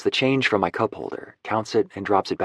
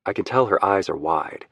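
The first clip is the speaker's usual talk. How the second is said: ordinary delivery, nothing marked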